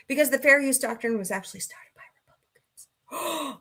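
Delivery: conspiratorial whisper, half-joking; mock secret whisper. Sharing a political fact with viewers in a mock whisper. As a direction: hush it like a dangerous secret. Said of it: "I said it out loud".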